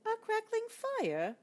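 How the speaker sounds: very high, slow timid mouse; ventured. Acted. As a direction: float the idea shyly. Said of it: "ventured the mouse".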